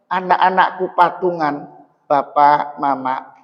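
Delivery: ordinary delivery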